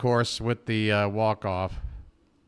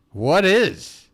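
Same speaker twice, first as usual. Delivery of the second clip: higher, louder emphatic repeat; excited; 6 semitones higher, 5 dB louder than usual. Real, not acted. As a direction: push the question up with excitement.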